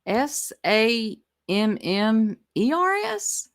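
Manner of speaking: slow letters, rising into disbelief; mock spelling. Host echoing the clip's misspelling, mock-puzzled. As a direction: spell it back slowly as an incredulous question.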